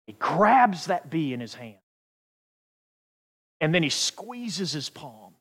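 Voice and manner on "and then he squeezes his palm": ordinary delivery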